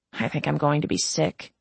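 ordinary delivery

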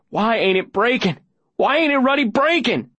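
high, rising exasperated cry; frantic frustration. Acted. Boy slamming fists on glass, frantic frustration.

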